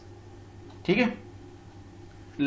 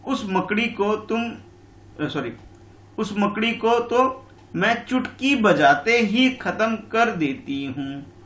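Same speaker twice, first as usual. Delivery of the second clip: boastful fly; 6 semitones higher, slower than usual. Acted. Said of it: "मक्खी ने बोला था".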